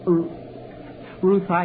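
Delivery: flustered stammer on her name; stuttering. Acted. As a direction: stumble over the first syllable. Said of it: "I stuttered like I always do when I get confused".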